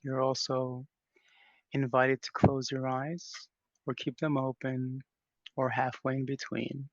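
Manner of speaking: unhurried meditation guidance with long pauses; guided meditation. Real, not acted. Leader invites the group to settle into practice, calm guided meditation. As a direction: ease listeners into stillness, leaving space between phrases.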